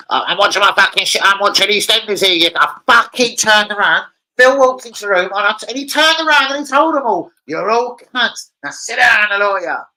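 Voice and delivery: loud, high, ranting soap re-enactment; shouted retelling. Retold or imitated. Telling viewers about EastEnders, shouted retelling. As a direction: belt out the soap row at high pitch.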